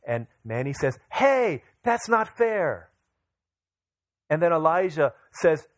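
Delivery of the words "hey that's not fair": put-on high, drawn-out kid's protest; whiny mock-outrage of a little kid; indignant child. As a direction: imitate a small child objecting, slow and high. Daughter protests at refused walk.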